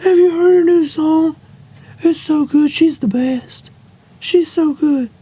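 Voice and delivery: high-pitched mock fangirl gushing; hammed-up swooning superfan; gushing fan.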